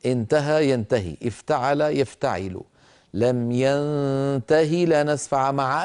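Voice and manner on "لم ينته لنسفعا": slow melodic tajweed chant; Quran recitation. Acted. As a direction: recite the verse slowly and tunefully.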